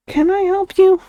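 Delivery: high sing-song pet voice; talking to a pet. Real, not acted. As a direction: pitch it up for the cat. Streamer addressing a loud pet.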